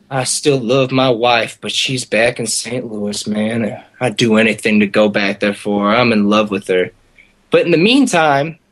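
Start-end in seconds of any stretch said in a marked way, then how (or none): 0.1-6.9 lower, subdued declaration of love; sentimental confession. Retold or imitated. Drunk guest confiding about his faraway wife, low and sincere. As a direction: imitate a drunk getting earnest, pitched low.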